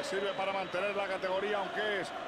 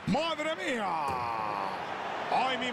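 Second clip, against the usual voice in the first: amazed exclamation. Acted. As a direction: react to a stunning shot. Amazed at a shot.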